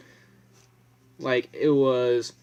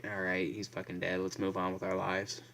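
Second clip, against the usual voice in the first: deadpan; 3 semitones lower, 8 dB quieter than usual. Retold or imitated.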